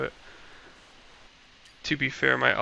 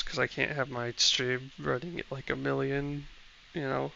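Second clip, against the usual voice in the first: normal talking voice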